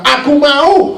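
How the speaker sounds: high, loud declaration; triumphant proclamation. Acted. The Lord answering the one who asks, triumphant proclamation. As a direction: ring it out to the whole congregation.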